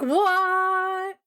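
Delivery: high, stretched-out mock surprise; imitated astonishment. The whole group reacting to the little sister line, mock astonishment.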